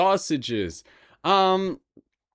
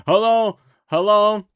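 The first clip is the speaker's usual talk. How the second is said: excited hello; 5 semitones higher, 6 dB louder than usual. Real, not acted. Greeting chat at stream start.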